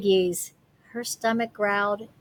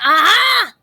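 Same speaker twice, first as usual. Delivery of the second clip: a sudden high, loud aha of discovery; the witch hits on an idea; excited aha; 9 semitones higher, 8 dB louder than usual. Acted. Gritch finding her answer, excited cry.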